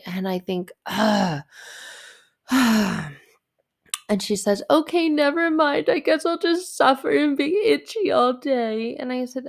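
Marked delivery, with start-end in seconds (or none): 0.8-3.1 louder exasperated sigh; retold groan let out as a heavy sigh. Mother hearing the dress is itchy, exasperated groan. Exasperated reaction to daughter's complaint. 4.7-8.9 put-on high, loud whiny martyr child; dramatic child. Daughter complaining to mother about itchy clothes, dramatic child.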